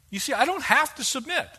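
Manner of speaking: ordinary delivery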